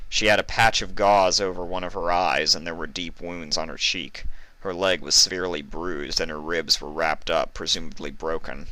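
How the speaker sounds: plain reading voice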